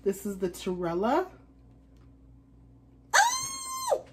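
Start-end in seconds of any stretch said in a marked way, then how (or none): 3.1-4.0 high squeal of surprise; sudden high oh; shocked gasp. Real, not acted. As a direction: yelp at the first sight. Sees signed shoe.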